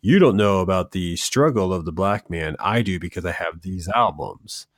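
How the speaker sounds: normal talking voice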